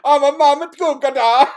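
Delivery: hammed-up Italian accent, high and loud; Italian Mario accent. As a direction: play a cartoon Italian plumber with a thick Italian accent. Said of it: "we're gonna go full fucking Mario".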